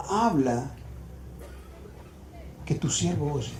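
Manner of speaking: low and soft, with a long pause; timid, awed. Acted. Boy dares not say the sacred name, timid and awed. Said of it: "él solamente dijo".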